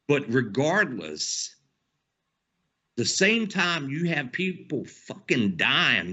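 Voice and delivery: ordinary delivery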